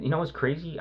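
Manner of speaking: ordinary talk, nothing marked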